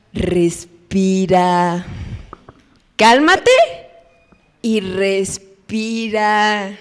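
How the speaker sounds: slow, drawn-out soothing respira; calming. Retold or imitated. Parent slowly calming a crying child. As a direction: stretch it out to calm a sobbing kid. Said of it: "siempre la palabra".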